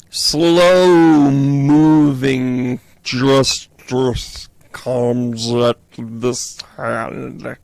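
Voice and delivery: drawn-out slow-motion voice. Pretend. Tarot reader clowning about slow justice, slow-mo voice. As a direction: stretch every word out like film in slow motion. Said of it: "Did you like my slow-mo?".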